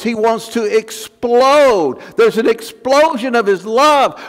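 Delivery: ordinary delivery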